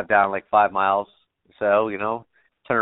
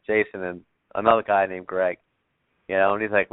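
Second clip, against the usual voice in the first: ordinary talk, nothing marked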